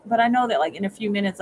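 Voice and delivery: ordinary talk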